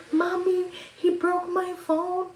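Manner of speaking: mock-tearful kid calling her mom; whiny crying. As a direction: whine to mom like a hurt kid.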